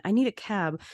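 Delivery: ordinary delivery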